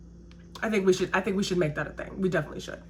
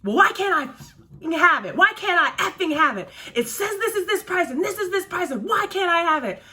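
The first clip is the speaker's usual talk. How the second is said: much higher, shrill-pitched mock rant; angry cursing customer; 8 semitones higher than usual. Customer cursing at the server over two proteins, mock yell. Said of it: "She gets louder and louder".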